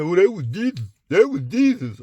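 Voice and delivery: mocked objector. Mimicking people who say that was Jesus, mocking high voice. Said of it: "you get people to say".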